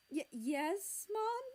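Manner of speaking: stammered, rising high on the question; feigned innocence. A narrator's reading. Child caught by his mother, nervously innocent. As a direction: play innocent after being caught. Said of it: "trying to put on an innocent smile".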